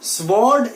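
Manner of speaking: "Sword" as SVORD, w sounded; slow "Sword" with an audible w; wrong sword. Shown as a demonstration. Teacher flags the error for students, w sounded. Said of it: "Sword is wrong".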